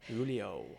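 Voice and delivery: Julio said "HOO-lee-oh"; Spanish pronunciation. Shown as a demonstration. Co-host questioning the storyteller's name, Julio with an H sound. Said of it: "Is that how you pronounce his name?".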